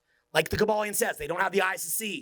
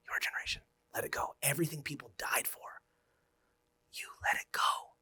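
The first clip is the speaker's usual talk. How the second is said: whisper; 2 semitones lower, 8 dB quieter than usual. Real, not acted. Solemn rebuke to his audience.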